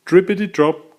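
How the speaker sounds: ordinary delivery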